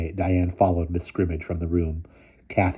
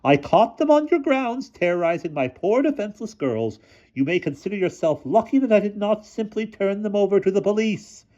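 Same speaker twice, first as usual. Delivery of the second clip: shrill headmistress; 14 semitones higher than usual. A narrator's reading. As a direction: play the fussy headmistress, pitched way up. Miss Scrimmage accusing.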